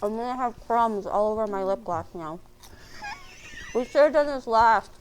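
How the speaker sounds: talking through a croissant; mouth full. Real, not acted. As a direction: speak around a mouthful. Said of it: "talking with your mouth full".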